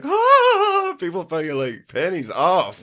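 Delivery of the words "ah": sudden very high, loud shriek; mock fan scream. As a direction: squeal like a fan who just spotted him. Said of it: "it'd be like".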